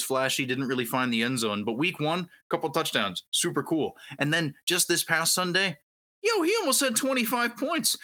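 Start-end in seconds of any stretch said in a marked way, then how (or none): 6.2-8.0 excited. Real, not acted. Hyped about big fantasy score.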